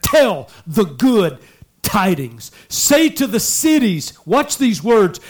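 loud, high, ringing proclamation; full-force preaching. Real, not acted. Preacher urging young people to proclaim the gospel, full force. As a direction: cry out the scripture, drawing out key phrases.

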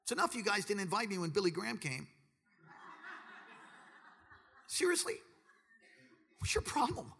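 hammed-up kid brother complaint; picked-on little brother. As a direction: protest like the bullied youngest child. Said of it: "Be like".

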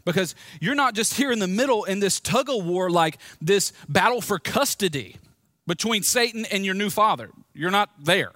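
usual speaking voice

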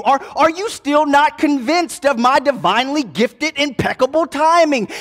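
high, loud scolding; exasperated rebuke, one question after another; Jesus scolding his frightened disciples; fussing out. Acted. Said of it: "they getting fussed out by Jesus".

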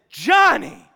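loud, high shocked scolding of the dog's name; scolding name call. Retold or imitated. Owner catching the dog that swallowed the steak, loud scold. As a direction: bark her name in disbelief. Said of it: "and I said".

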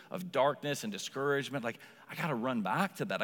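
normal talking voice

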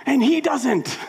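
high, loud, slow mock shout; retold shout. Pastor shouting at his friend over baptism, high loud shout. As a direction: yell it like you're sure you're right. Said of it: "And I'm like".

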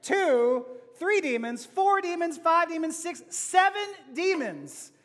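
seven demons slow and punched; counting up with emphasis. Shown as a demonstration. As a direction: count them off, building. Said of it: "Let's count that".

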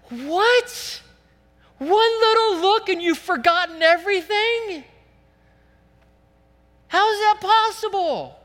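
very high, loud, scandalized rebuke; incredulous outcry. Acted. Preacher voices James scolding forgetful hearers of the Word, incredulous. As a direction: cry out in hammed-up disbelief.

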